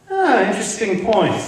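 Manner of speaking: ordinary delivery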